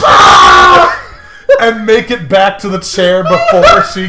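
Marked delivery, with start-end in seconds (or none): 0.0-1.0 loud, low hammed-up ah of relief; loud mock groan of relief; mock relieved shout. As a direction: bellow of relief on the toilet. Acted relief in bathroom.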